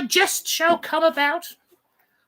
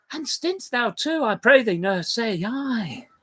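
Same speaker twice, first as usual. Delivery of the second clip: put-on softer and lower young girl pleading; Juliet hushing; 7 semitones lower, 5 dB quieter than usual. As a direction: gently beg the nurse to stop. Juliet hushes the nurse.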